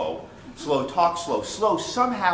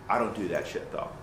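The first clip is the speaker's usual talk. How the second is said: ordinary talk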